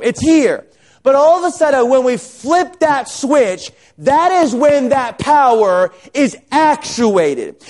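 high, emphatic preacher delivery; full-force preaching. Acted. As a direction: drive each point home with stretched key words.

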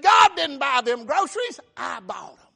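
loud, high, emphatic taunt; acted scoffing neighbor; gloating outburst. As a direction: crow it at full pulpit volume. Preacher acts out gloating atheist neighbor.

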